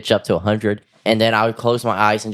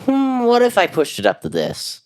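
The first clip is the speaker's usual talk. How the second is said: curious musing; 14 semitones higher than usual. Retold or imitated. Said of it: "I'm like".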